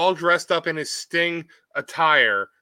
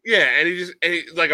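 normal talking voice

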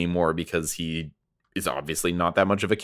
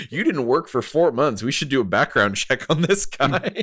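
amused clerk voice; 8 semitones higher than usual. Retold or imitated. Imitating IRS clerk.

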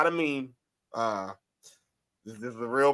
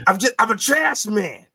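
higher mock trash man catchphrase; higher, louder imitation of the trash man; 9 semitones higher, 5 dB louder than usual. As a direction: nail the clip's voice, pitched up. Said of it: "That's it".

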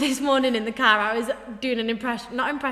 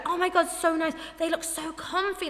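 high, gushing compliment; imitated admiration; 6 semitones higher, 4 dB quieter than usual. Imitating guests admiring cheap heels, gushing. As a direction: gush over the shoes, pitched up. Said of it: "My shoes were like".

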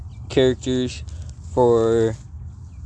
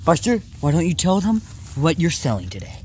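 ordinary talk

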